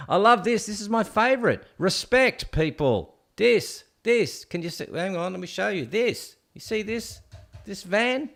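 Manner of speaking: high, eager showing-off; excited. Real, not acted. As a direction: gush over the dream van. Showing off favourite van.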